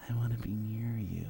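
soft, low creepy murmur; soft low mock creep voice; creepy inner voice. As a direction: voice the stalker's thought quietly. Voicing the creep's silent intent.

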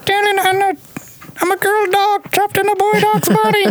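squeaky dramatic diva pet voice; high camp dog voice. Pretend.

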